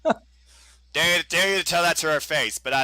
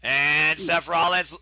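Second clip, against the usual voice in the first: excited play-by-play; 9 semitones higher than usual. Acted. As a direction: shout the near fall live. Live play-by-play, near fall.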